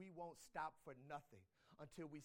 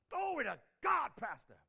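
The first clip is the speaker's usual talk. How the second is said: high, loud church shout; shouting glory to the congregation; joyful shout; 8 semitones higher, 17 dB louder than usual. Real, not acted. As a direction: cry out praise. Preacher calls for shouting crowd.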